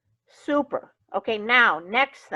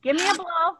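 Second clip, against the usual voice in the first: ordinary talk, nothing marked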